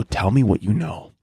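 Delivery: low-pitched, menacing demand; mock interrogation. Acted interrogation of a captured seagull.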